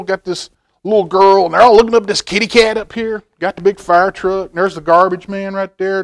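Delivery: ordinary delivery, nothing marked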